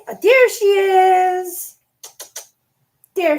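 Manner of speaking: high, slow, loud sing-song to the dog. Real, not acted. Owner greeting her dog in high loud baby talk. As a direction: stretch it out with delight, cooing.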